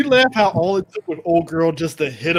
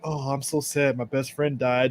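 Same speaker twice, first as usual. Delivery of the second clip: mock sad and quiet; 3 semitones lower, 8 dB quieter than usual. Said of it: "he's all sad and quiet".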